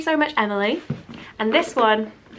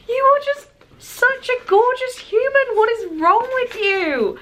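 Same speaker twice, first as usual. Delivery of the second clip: much higher and louder, laughing gush; delighted laughing squeal of thanks; overjoyed; 12 semitones higher, 5 dB louder than usual. Real, not acted. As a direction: squeal affectionately at a friend. Learns the gift is from Victoria.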